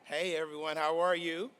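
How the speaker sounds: usual speaking voice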